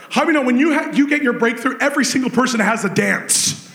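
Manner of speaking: usual speaking voice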